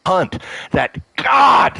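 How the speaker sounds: real cry breaks out, high and slow; voice breaking. Real, not acted. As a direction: choke up, crying out the words. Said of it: "I'm gonna get emotional".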